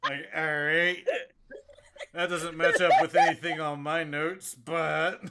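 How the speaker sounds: talking through laughter; laughing. Real, not acted. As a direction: giggle through the words. Laughing at the notes.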